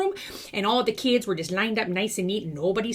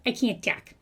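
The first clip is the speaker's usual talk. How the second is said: ordinary talk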